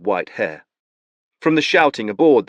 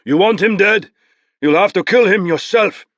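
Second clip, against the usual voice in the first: calling out; 11 semitones higher, 7 dB louder than usual. Acted. Palamedes calling back to Nabor.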